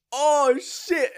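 high-pitched mock dismay; hammed-up alarmed discovery; dawning realisation. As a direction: gasp it out as the mistake sinks in. Acts out newcomer realising his mistake.

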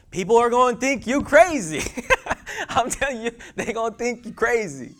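high, loud preacher's cry; preaching at full force. Acted. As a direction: shout it out with a laugh.

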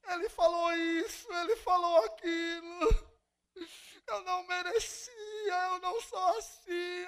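high, far-off whimpering voice of a self-pitying churchgoer; whiny bitter victim. Retold or imitated. Imitates bitter crying church member.

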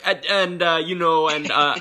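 high, loud stumbling filler; stalling student, hammed-up nervous; mock stammering student. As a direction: play a flustered kid inching to the door.

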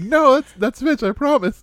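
very high-pitched little chef character; Fitch. Acted. Player assuring confused friends who is talking, high character voice. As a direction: protest in a high put-on voice. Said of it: "you just said that in Fitch's voice".

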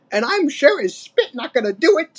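put-on high crabby old ape; Cranky, snappish. A narrator's reading. Old ape refusing the adventure, snappish. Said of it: "snapped Cranky".

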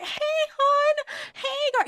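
very high-pitched, sing-song hello; chirpy greeting. Retold or imitated. Host dodging a name she's unsure of, chirpy. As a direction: greet with exaggerated friendliness.

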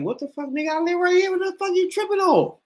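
high, heated mock rant; indignant outburst. Resident snapping back at the man questioning him, high indignant outburst. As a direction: fire it back high and offended. Acted confrontation with neighbor.

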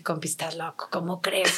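low, soft retold dismissal; incredulous brush-off. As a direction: wave the idea away under your breath. Retells rebuffing a colleague's idea.

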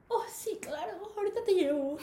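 high, soft put-on British accent; badly faked British English lilt in Spanish; mock British accent. Supervisor agrees to take her, mock British accent. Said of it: "pero con acento británico".

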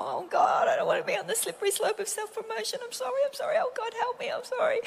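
very high, hurried mock begging; frantic pleading. Speaker replays twenty minutes of apologising to God, frantic pleading.

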